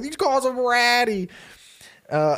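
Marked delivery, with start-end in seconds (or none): 0.0-1.3 high, put-on jeering voice; making fun. Kids telling each other about Ben's pet name, high mocking. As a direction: ridicule him to the others, pitched way up. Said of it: "making fun of Ben".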